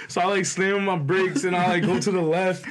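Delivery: ordinary delivery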